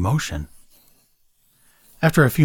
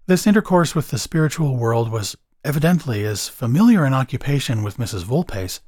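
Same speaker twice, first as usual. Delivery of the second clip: plain reading voice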